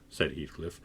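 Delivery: plain reading voice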